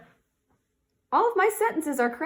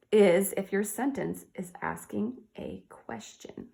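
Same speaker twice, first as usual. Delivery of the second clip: ordinary talk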